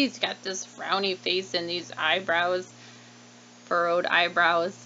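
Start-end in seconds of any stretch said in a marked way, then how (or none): none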